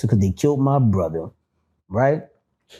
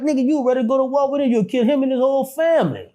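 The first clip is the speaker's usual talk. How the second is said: imitated angry friend; 11 semitones higher, faster than usual. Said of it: "you would be like".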